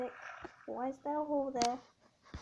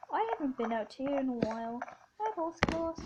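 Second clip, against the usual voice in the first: ordinary delivery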